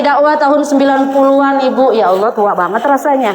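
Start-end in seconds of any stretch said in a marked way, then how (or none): none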